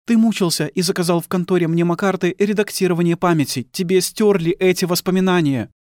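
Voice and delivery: high-pitched, bitter woman's voice; accusing wife. Acted.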